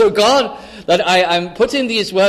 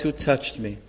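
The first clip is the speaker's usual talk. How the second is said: hushed question; 7 semitones lower, 9 dB quieter than usual. Acted. God voiced asking softly.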